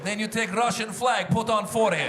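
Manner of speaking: deadpan Russian plotter giving instructions; Russian official in a comedy bit. Acted.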